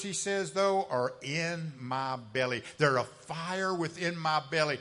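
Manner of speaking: usual speaking voice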